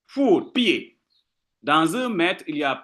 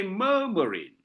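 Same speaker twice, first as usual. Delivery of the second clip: "murmuring" with MUR held, then two light syllables; stress on first syllable; 5 dB quieter than usual. Shown as a demonstration. Teacher giving a dactyl example, first syllable of "murmuring" stressed. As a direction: lean on the start of "murmuring". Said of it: "one stressed syllable".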